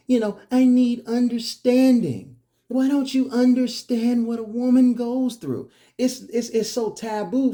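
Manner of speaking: high, loud put-on wife's voice; mock complaining woman. Speaker mimicking women who demand understanding, high mock complaint. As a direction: plead indignantly in a mocking, high-pitched voice.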